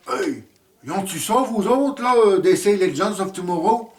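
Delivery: put-on Québécois accent. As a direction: hail the Quebec cousins in a broad Québécois accent. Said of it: "j'ai pas perdu mon accent". (accent québécois exagéré, plus aigu et plus fort)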